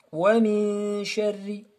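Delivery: "وَمِن" held in a long hum; model recitation. Shown as a demonstration. Teacher recaps for students, hummed "وَمِن". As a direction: sustain the hidden n into "شَرِّ".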